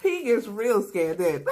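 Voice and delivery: can't stop laughing while telling it. Real, not acted.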